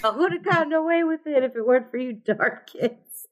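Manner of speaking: high, laughing villain complaint; hammed-up high-pitched villain; unmasked cartoon villain. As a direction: whine it like a foiled cartoon crook, half laughing. Mock Scooby-Doo unmasked villain quote.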